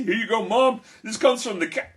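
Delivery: high, cheery offering; proud presenting. Acted. Jack imagining giving butter to Mom, proud.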